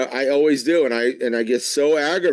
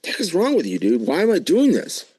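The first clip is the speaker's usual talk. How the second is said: higher, exasperated mock Paul; voicing Paul's frustration with himself; imitating Paul; 7 semitones higher than usual. [name] voicing the apostle Paul, higher and exasperated. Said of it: "I can almost hear him saying".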